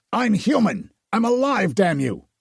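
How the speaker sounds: desperate bellowing protest; bellowed. Acted. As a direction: yell it to stop the struggle. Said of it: "Nathaniel bellowed".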